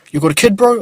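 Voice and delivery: high, loud and pushy; loud street stranger. Retold or imitated. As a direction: fire the question up high and loud. Stranger asks about kid.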